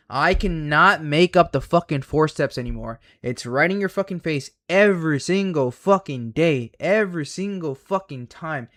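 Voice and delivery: loud, frustrated ranting; heated rant. Real, not acted. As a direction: hammer it home, exasperated. Ranting at viewers about strategy.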